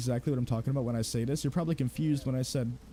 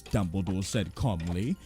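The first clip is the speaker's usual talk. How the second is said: calm narration; 6 semitones lower than usual. Retold or imitated. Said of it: "every other line is".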